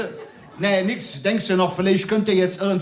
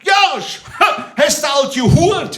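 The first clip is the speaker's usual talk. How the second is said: much higher, loud mocking yell; imitating a heckler; 9 semitones higher, 7 dB louder, slower than usual. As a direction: bellow it like a cocky guy trying to be funny. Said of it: "der sagt".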